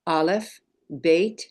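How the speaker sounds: one letter name at a time, pausing after each; measured roll call with a pause between names; call-and-repeat recitation. Shown as a demonstration. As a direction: recite the alphabet slowly for learners to echo. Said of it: "go ahead and do that with me".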